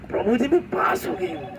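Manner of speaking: high, bright imitation of a thrilled young woman; overjoyed news. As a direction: squeal out the good news. Devotee phones with exam result.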